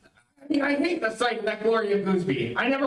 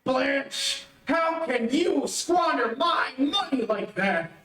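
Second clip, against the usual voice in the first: outraged; 5 semitones higher, slower than usual. Acted. Husband outraged at price.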